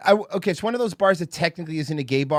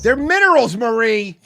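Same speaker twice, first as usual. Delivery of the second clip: high and loud emphatic take; quoted catchphrase; 8 semitones higher, 10 dB louder, slower than usual. Retold or imitated. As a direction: fire it off high and indignant. Quoting a TV line twice.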